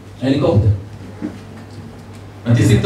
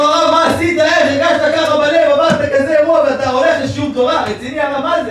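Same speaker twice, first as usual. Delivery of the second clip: alarmed outcry; 10 semitones higher than usual. Retold or imitated. Shamash to the rabbi after the heart attack, high alarmed outcry.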